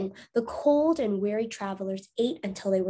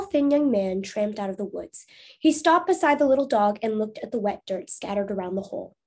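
ordinary delivery, nothing marked

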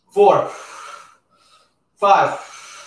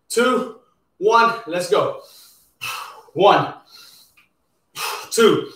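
ordinary talk

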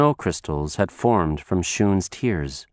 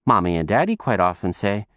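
ordinary delivery

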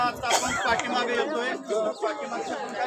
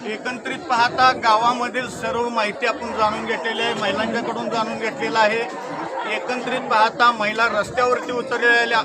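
news report; 7 dB louder than usual. Real, not acted. Reporter wraps up on camera.

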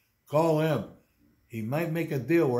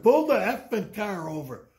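loud, higher angry command to get out; retold angry order; 7 semitones higher, 6 dB louder than usual. Tour guide ordering an interrupting passenger out, loud and angry. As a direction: throw the pesky guy out, yelling. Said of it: "I told him".

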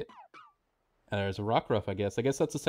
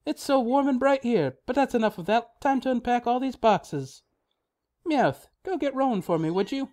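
high, cheery motherly voice, a good deal higher; cheerful mom voice; 10 semitones higher than usual. Acted. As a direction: read it like a bubbly mom welcoming her kid. Voices the mom's dialogue.

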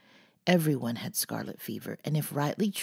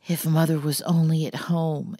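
louder anxious exclamation; louder worried outburst; exclaiming; 3 semitones higher, 7 dB louder than usual. A narrator's reading. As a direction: burst out wishing for mother. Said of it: "exclaimed Jo".

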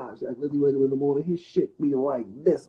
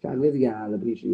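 usual speaking voice

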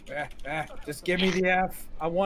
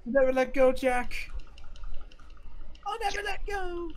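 Titanic parody; 11 semitones higher than usual. Pretend. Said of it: "Titanic quote".

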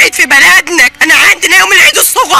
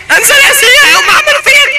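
anguished high cry; 8 semitones higher than usual. Real, not acted. Wife refusing the host's advice, a high wail. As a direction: voice jumps much higher in protest.

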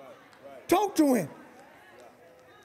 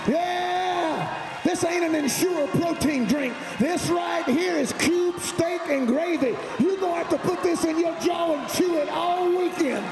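big shouted preacher cadence, pitch raised; preaching at full force; 5 semitones higher than usual. Real, not acted. Pastor preaching to the congregation, full force. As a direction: preach it to the back row.

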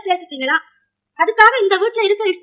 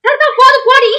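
high, loud brush-off; sharp, loud order to go; angry dismissal; 7 semitones higher, 5 dB louder than usual. Acted. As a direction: cut her off loudly, pitched high. Cuts the girl off and dismisses her.

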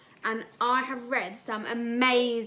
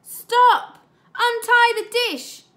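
high, loud storybook scream; 12 semitones higher, 13 dB louder, slower than usual. Acted. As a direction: yell it out to save the dish. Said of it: "I screamed".

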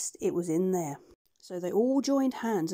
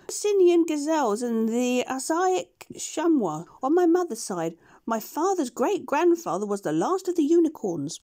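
much higher, shy creature voice; gentle beast agreeing; 6 semitones higher, 6 dB louder than usual. Acted. Push-Me-Pull-You agrees to go with the doctor, high shy voice.

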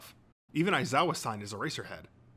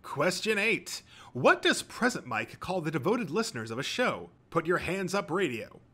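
higher, showy quiz host; quizmaster; 6 semitones higher, 3 dB louder than usual. A narrator's reading. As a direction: announce the question to a crowd.

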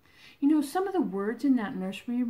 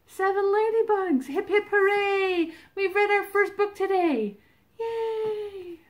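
excited cheer; 9 semitones higher, 8 dB louder than usual. Real, not acted. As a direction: cheer it out high and proud. Cheering with children after counting.

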